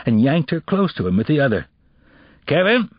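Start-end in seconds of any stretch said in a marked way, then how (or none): 2.5-2.9 stern call. Acted. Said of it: "a man's voice called sternly".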